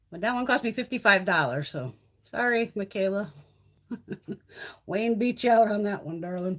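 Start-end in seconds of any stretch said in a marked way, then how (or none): 3.9-4.8 quiet, breathy chuckle; short laugh. Real, not acted. Vlogger amused by her own mock apology, soft chuckle. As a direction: chuckle softly to yourself.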